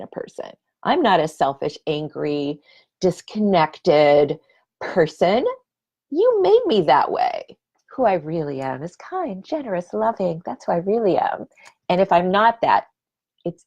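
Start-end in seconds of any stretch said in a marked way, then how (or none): 6.1-7.6 high, loud accusing whine; blaming voice. Retold or imitated. Mocking her old self blaming her partner, high accusing whine. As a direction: throw the blame back at him.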